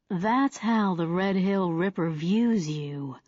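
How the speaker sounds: slow, soft southern drawl. Acted. Old profiler explaining the killer to Darby, slow southern drawl. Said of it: "in his soft southern drawl".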